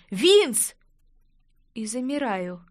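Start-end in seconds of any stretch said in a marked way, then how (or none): 0.1-0.7 higher, urgent shout; short alarmed call; cry of a name. A narrator's reading. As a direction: burst in calling his name. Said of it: "влетаю в номер с криком".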